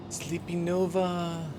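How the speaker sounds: high, soft, slow coo; whisper-coo to a sleeping pet; tender pet talk. Real, not acted.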